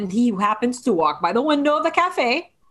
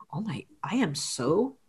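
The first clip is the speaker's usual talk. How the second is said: whispered apology; 7 semitones lower, 5 dB quieter than usual. Acted. Acting out his apology to her, in a hushed whisper. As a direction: whisper it haltingly, much softer.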